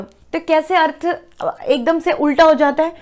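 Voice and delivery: usual speaking voice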